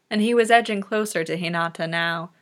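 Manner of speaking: plain reading voice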